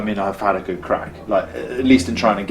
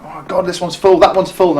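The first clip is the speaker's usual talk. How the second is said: higher-pitched, mildly frantic recounting; panicked re-enactment; 8 semitones higher than usual. Retold or imitated. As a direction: act out being left without a place. Reenacting panic finding trucks full.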